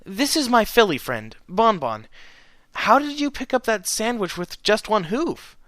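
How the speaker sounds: high, bubbly and eager; a perky pony gushing with curiosity; excited high mare voice. A narrator's reading. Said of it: "the minty mare asked".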